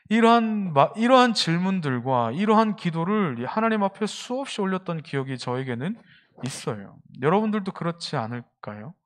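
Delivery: normal talking voice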